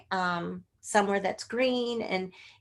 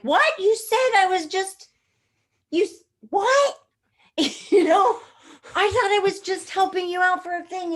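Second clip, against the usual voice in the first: loud, high-pitched disbelief; shocked protest; 11 semitones higher, 6 dB louder than usual. Retold or imitated. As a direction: re-enact being floored by unexpected news. Retells shock at landing the pilot.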